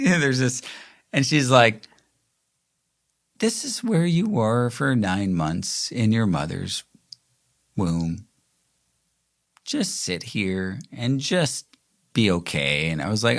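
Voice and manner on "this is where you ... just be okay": a hint of a slow, soft guide voice; breath work guide voice. Breathing coach guiding the group in fetal position, lightly soft. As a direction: lightly ease into it like a calm instructor. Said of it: "Beth has this, like, voice".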